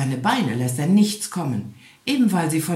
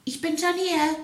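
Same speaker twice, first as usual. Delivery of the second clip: much higher called-out "Ich bin schon hier"; wife calling out; 13 semitones higher than usual. A narrator's reading. The wife calls to the arriving hare: much higher call. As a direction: call across the field, high. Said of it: "rief ihm der Igel seine Frau entgegen".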